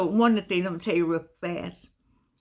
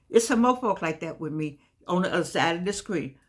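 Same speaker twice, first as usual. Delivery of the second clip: usual speaking voice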